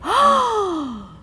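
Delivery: a high, startled cry of panic; mock panic. Patient alone in her car realises treatment is over, high panicked cry. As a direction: jolt up in alarm on the word. Panic after leaving clinic.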